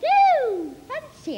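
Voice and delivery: high, prim mock amazement; mock surprise. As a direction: gush in fake astonishment.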